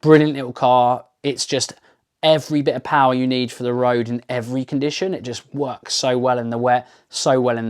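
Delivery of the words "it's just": ordinary talk, nothing marked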